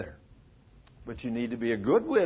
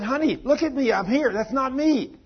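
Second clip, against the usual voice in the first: high, loud desperate plea to be noticed; retold screaming; 11 semitones higher, 6 dB louder than usual. Dying man pleads with his wife beside the bed, retold screaming. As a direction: call out urgently, pitched well up. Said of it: "I'm screaming in her ear".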